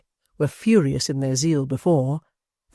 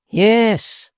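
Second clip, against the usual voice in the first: loud defiant yes; 7 semitones higher, 6 dB louder than usual. Acted. Hugh's defiant answer.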